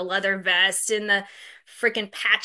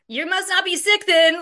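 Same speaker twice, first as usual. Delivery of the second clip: high, loud snide put-down; mock snippy receptionist; snotty retort; 9 semitones higher, 5 dB louder than usual. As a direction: sneer it at the caller. Mocking her rude phone replies.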